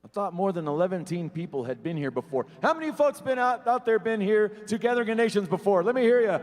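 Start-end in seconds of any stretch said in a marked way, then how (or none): 2.6-6.4 much higher, louder hype call; louder crowd call-out. Real, not acted. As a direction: really fire up the crowd. Emcee asks crowd again, louder.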